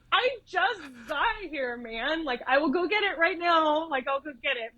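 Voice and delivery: high-pitched mock exasperation; exasperated protest. Nurse pressed by neurosurgeon just after arriving, mock exasperated.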